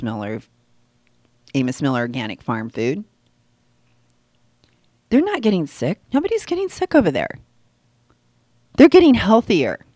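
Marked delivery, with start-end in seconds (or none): none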